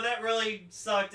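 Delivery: ordinary talk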